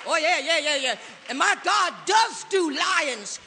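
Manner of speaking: loud, high and triumphant; exultant preaching shout. Acted. Preacher rejoicing over his God before the congregation, loud. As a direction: shout it like a victory cry.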